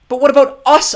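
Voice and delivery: put-on very high, loud appalled boy; horrified. A narrator's reading. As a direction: cry out in horror at the betrayal. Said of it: "Klaus asked in horror".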